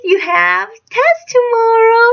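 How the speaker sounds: retold announcement. Retelling what was sprung on the student, high mocking call.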